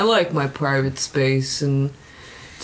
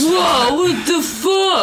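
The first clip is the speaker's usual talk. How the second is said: startled shout; 11 semitones higher, much slower than usual. Acted. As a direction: jump at the noise and shout. Glass smashing outside the bar.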